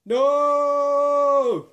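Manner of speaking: long, high, loud anguished yell; Luke's scream. Pretend. Vlogger acting Luke's famous denial, hammed-up screaming. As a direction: howl it out like Luke losing his hand. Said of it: "I've gotta do the scream now".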